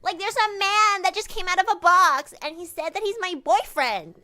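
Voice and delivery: high, loud alarmed-daughter act; calling for Daddy. Acted. Daughter reporting a man from a box, high and loud. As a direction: cry out to Dad in mock panic.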